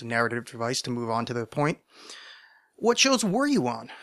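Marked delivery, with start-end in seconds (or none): 2.8-3.9 high, eager question voice; imagined listener. Pretend. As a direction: ask it as a keen fan. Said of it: "invented narrative device".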